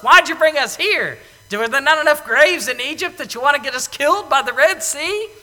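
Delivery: a hint of angry crowd; grumbling complaint. People grumbling at Moses by the sea, mild mock anger. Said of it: "The people grumbled to Moses".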